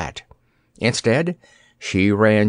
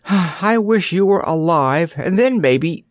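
slow, wistful and high; longing, drawn out; wistful sigh; 7 semitones higher than usual. A narrator's reading. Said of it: "sighed Dorothy at last".

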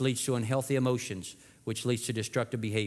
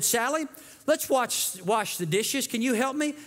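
a lightly raised, sweet grandma voice; lightly voiced grandmother asking for help; grandma's voice; 10 semitones higher than usual. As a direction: ask like a kindly grandma. Grandma voiced in sermon story.

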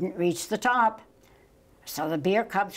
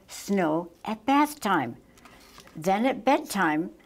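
plain reading voice

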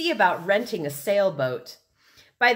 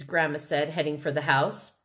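ordinary delivery, nothing marked

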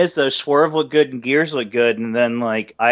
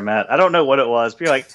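normal talking voice

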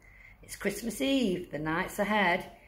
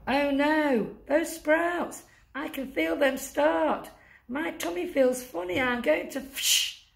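panicky Santa; 8 semitones higher than usual. Acted. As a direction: fret about an oncoming fart, voice up high. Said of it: "says the elf".

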